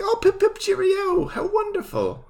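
very high, fluting British caricature; hammed-up British voice, way up high; posh British accent. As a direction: chirp it like a cartoon posh Brit. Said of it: "his posh British accent".

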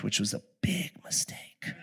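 soft rueful whisper; whispered aside. Real, not acted. Pastor admitting his coaching mistake, whispered. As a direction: whisper it like a confession.

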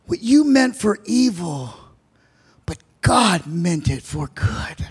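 the forgiving brother, softly kind; spoken kindly. Retold or imitated. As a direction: gently reassure your brothers. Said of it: "he did it kindly".

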